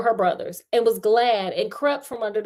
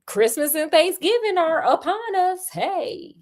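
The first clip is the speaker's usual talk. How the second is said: cheeky teasing; 8 semitones higher than usual. Retold or imitated. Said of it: "I'm like".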